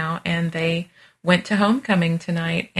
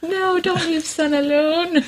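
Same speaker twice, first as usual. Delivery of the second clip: mock pleading; 11 semitones higher, slower than usual. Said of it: "And I'm like".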